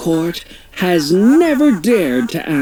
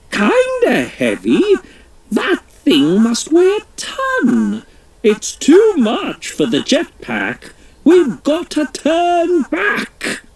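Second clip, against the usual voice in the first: high, disbelieving echo building to alarm; pitched-up royal panic rising; incredulous Queen; 12 semitones higher than usual. Acted. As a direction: toss the words back in shock.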